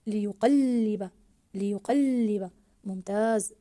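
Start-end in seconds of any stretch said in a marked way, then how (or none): 0.1-2.5 "ليقلب" loud, the second ل doubled and held; stressed doubled letter. Shown as a demonstration. Teacher modelling the doubled lam, stressed doubled letter. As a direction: punch and stretch the ل after ق in "ليقلب". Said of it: "ضاعفنا حرف اللام".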